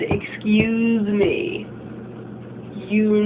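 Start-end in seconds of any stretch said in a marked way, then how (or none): 0.0-1.7 very slow, drawn-out hurt excuse me; imitating a crushed boyfriend, slow and drawn out; heartbroken excuse me. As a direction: let the heart sink on each word. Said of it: "how sad he is just from him saying those two words".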